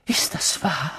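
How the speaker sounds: breathless whisper of disbelief; stammered whisper. Acted. Stunned professor asking Dumbledore if it is true, stammering. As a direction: stumble over the words in shock. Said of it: "stammelte Professor McGonagall".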